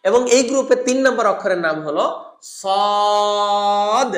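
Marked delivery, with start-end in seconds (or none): 2.6-4.1 "সদ" very drawn out, thick, pushed ending; heavy long Saad. Shown as a demonstration. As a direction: hold "সদ" long, bounce the d. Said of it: "এটা যেমন মোটা ধ্বনির অক্ষর". (মোটা করে টেনে "সদ", শেষে ধাক্কা)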